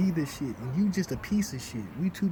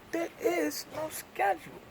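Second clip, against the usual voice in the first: high, incredulous mock retort to the boss; rising outraged quote; indignant retort; 11 semitones higher, 4 dB quieter than usual. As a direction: throw it back at him in disbelief. Retelling argument with boss.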